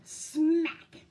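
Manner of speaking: drawn-out S into a loud hit; loud smack noise. A narrator's reading. Fish hits Skunk, loud explosive smack. Sound effect in picture book.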